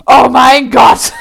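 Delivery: loud, drawn-out dramatic cry; overwhelmed exclamation. Acted. Reader at the crush's date question, overwhelmed cry. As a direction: cry it out slowly at full volume.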